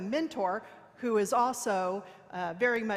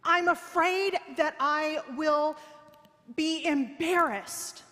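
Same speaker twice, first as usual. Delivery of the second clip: high, slow, louder mock distress; mock distressed protest; 6 semitones higher, 4 dB louder, slower than usual. Coached woman admitting her fear to her mentor, hammed-up distress. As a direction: draw it out high and loud, playing up the dread. Acted whiny answer to mentor's question.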